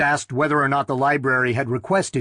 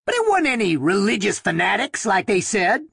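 excited, higher, pushing toward falsetto; excited, rising to falsetto; 6 semitones higher than usual. A narrator's reading. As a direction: let the voice climb into falsetto in agitation. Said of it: "Haskins said excitedly, his voice rising to a falsetto".

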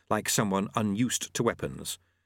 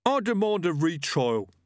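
lightly voiced thug; 9 semitones higher, 3 dB louder than usual. A narrator's reading. Gripper voice.

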